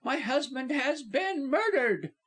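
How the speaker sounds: high, urgent wife; distraught, high-voiced urging; impatient. A narrator's reading. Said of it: "she answered impatiently".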